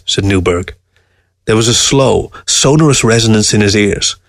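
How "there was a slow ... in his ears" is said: ordinary delivery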